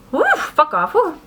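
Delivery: sudden high tic outburst; involuntary yelp breaking the sentence; vocal tic. Real, not acted. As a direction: tic cutting into the story. Vocal tic interrupting story.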